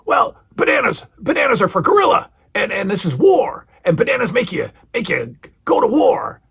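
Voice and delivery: very high, intense celebrity impression; Mister T. Mister T connecting bananas to warfare, intense impression. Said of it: "Mister T goes".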